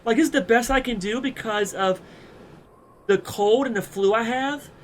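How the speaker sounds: a lightly voiced girl, held back by a cold; girl's voice attempt. Shown as a demonstration. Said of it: "I can't really do a girl's voice".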